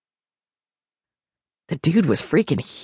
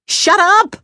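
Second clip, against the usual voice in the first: high, loud shout for silence; 6 dB louder than usual. A narrator's reading. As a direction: yell it to silence the room. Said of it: "I shouted".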